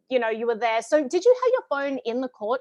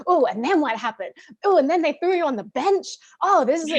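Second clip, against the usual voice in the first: gossipy gasp; 5 semitones higher, 5 dB louder than usual. Pretend. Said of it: "This is like".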